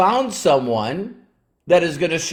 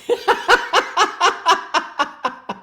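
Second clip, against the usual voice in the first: long staccato high-pitched laugh. Real, not acted. Speaker on students who won't listen, staccato high laugh. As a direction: rattle out a string of short, high, even giggles. Laughs after wry remark on quitters.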